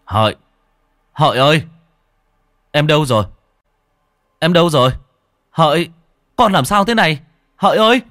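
husband calling his wife in alarm, frantic shout. Acted. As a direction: call out in panic. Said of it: "tiếng gọi thất thanh".

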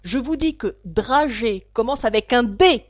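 loud, irritated correction; king crying out sharply; sharp shout. A narrator's reading. The king corrects the hatter about dragée, shouting sharply. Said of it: "cria le roi vivement".

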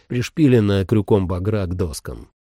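plain reading voice

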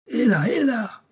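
shout. A narrator's reading. Driver yelling at thieves stealing his car, high alarmed shout. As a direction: yell out in alarm as the car is taken. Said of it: "cria ma pipe en se relevant".

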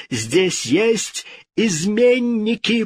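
high, drawn-out accusation; indignant cry. A narrator's reading. King hearing fleeing steps, indignant. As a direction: cry treason, high and slow. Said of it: "вскричал он".

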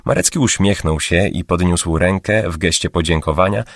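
ordinary delivery

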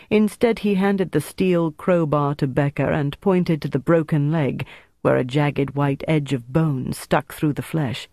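ordinary delivery

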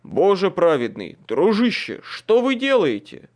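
loud, high, alarmed; alarmed shout. Acted. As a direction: cry out in alarm. Alarmed knight.

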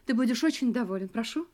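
normal talking voice

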